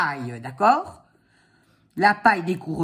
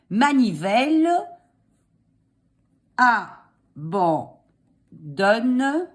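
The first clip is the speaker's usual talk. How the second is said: dictating; slower than usual. Shown as a demonstration. Dictating slowly.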